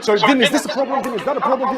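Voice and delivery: mock a pestering student; imitated anxious questioner. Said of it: "So they say things like this".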